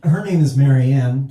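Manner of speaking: ordinary talk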